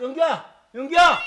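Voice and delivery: loud call across the house. Acted. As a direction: shout the name to find them. Calling out for someone.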